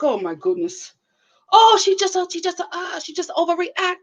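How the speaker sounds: mock Binion's accent; imitating Binion's accent. Mocking husband Binion waving off his wife, in his accent. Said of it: "this accent".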